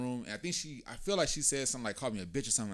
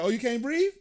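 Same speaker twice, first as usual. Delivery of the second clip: taunting; 12 semitones higher, 6 dB louder than usual. Retold or imitated. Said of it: "I was like".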